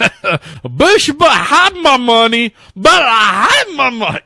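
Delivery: high, loud, drawn-out comic demand; movie character holler. Retold or imitated. Host echoing co-host's movie quote about money, loud high holler. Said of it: "the character from Gonna Get You, Sucker".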